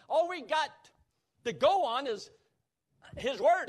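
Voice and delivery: high, very loud proclamation; full-force preaching. Real, not acted. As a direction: shout it from the pulpit. Preaching peaks in volume.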